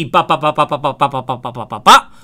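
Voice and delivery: rapid-fire ba ba syllables, mocking a hurried caller; rushed cadence. Shown as a demonstration. Coach showing agents the rushed cadence to avoid, rattled off. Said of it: "Sometimes your cadence shouldn't be".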